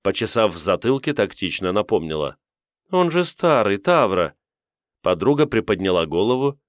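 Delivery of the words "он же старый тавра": surprised. Acted. Semal blurts in shock.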